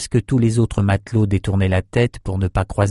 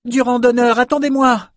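high, sustained hail; urgent high-pitched plea to wait; crying out; 14 semitones higher than usual. A narrator's reading. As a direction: call up to the ship in a high voice. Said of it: "cria-t-il".

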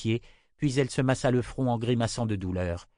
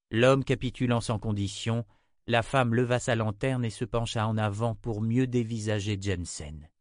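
usual speaking voice

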